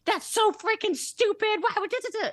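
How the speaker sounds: high, loud mock tirade; mock yelling. Leader showing how not to coach, mock yelling. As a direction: yell it like an aggressive boss, trailing into gibberish. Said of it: "You don't have to yell at people".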